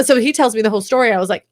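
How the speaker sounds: usual speaking voice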